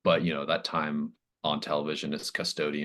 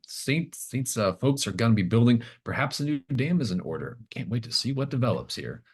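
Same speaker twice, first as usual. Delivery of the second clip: higher, bright TV-reporter sign-off; news reporter voice; 7 semitones higher than usual. Acted. Game master voicing an eavesdropping reporter, mock news delivery. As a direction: play a chirpy live reporter. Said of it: "like, a reporter, eavesdropping and saying".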